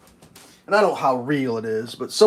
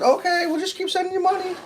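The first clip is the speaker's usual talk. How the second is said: high mock official. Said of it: "They just said".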